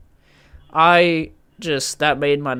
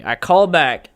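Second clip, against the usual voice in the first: ordinary talk, nothing marked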